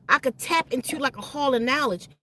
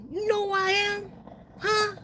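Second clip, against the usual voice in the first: high, mocking tough-guy challenge; angry threat; 8 semitones higher, 4 dB quieter than usual. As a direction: throw his threat back, pitched way up. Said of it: "He started getting mad".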